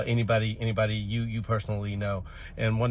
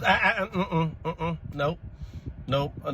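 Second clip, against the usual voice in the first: stammered, higher evasive denial; flustered dodge; 5 semitones higher, slower than usual. Real, not acted. Teacher dodging a pupil's embarrassing question. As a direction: wriggle out and change the subject.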